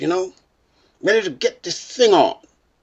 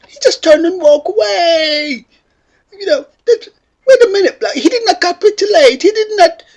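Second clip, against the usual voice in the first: laughing outrage; 11 semitones higher than usual. Retold or imitated. Outraged laughing disbelief to his friend.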